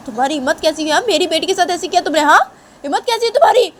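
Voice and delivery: Shanaya's father yelling in outrage; outraged father. Acted. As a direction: demand how he dared.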